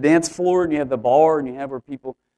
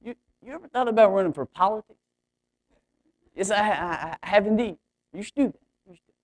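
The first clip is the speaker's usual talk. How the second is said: put-on caricature Oklahoma accent; 5 semitones higher than usual. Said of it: "a caricature of people from Oklahoma".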